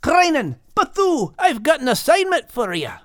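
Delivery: high called-out summons; calling out. A narrator's reading. Max calling seagulls across the beach, high called-out voice. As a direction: call the gulls over. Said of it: "yelled Max".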